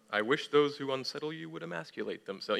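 soft, even scripture reading; calm, respectful reading. Retold or imitated. Preacher imitating how Bubba read the verse, calm and respectful. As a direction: read it gently, as if nothing were shocking. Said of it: "You know, so calmly".

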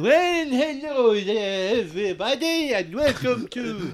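hammed-up high presenter; mock host. Mock host introduction in improv.

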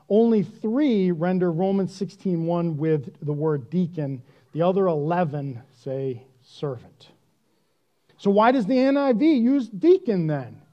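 normal talking voice

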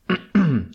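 quick throat clearing. Real, not acted. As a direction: clear your throat sharply. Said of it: "Desculpa lá a tossezinha".

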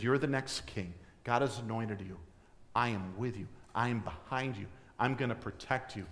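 soft, quiet reassurance; tender pledge. Acted. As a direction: promise a friend your protection. Jonathan pledges loyalty to David.

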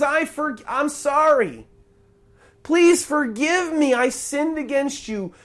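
remorseful begging that gradually fades; pleading apology. Acted. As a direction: beg for forgiveness, letting the voice fall away. Acting sinner begging forgiveness.

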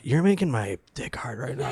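put-on whispered Russian accent, seductive; mock Russian woman whispering; sultry Russian whisper. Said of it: "the women have the same".

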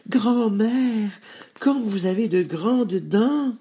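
put-on very high, slow child's voice; high-pitched, astonished child; little girl. A narrator's reading. Girl alarmed at the wolf's teeth.

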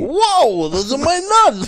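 high-pitched, alarmed Italian protest; mock Italian outrage. Co-host joining the road-rage bit, high mock-Italian protest. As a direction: yelp it like an offended Italian guy.

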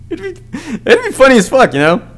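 ordinary talk

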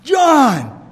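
high, loud outburst of a regained voice; sudden shout. Acted. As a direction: burst out as if speech has just returned. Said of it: "all of a sudden he's like".

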